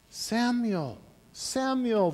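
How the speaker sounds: high, drawn-out falling call. Acted. The voice calls Samuel a second time, calling out. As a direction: call the name as if from afar. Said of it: "the voice calls".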